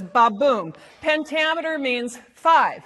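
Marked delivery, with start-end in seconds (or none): 0.0-0.7 ba-boom with the BOOM hit hard; light "ba", heavy "boom"; stress on second syllable. Shown as a demonstration. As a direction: punch the boom in "ba-boom". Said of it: "second one gets the emphasis".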